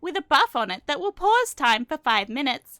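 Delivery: loud, squawky bouncy penguin. Pretend. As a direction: read it like a cheerful cartoon penguin. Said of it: "Should I read it in my penguin voice?".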